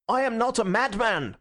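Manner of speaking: high, passionate protest; crying out. A narrator's reading. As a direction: protest desperately to the whole room. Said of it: "he cried out".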